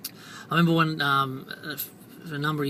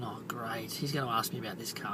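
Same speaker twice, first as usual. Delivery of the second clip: quiet unvoiced groan of dread, to himself; whispered dread; 5 semitones lower, 9 dB quieter than usual. Salesman dreading the car question, whispering to himself. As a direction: whisper it like a private inner groan.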